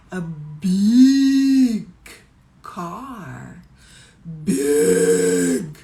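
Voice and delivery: hugely swelled BIG, loud and long; expressive big. Shown as a demonstration. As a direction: make the word big sound enormous. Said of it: "o meu tom de voz".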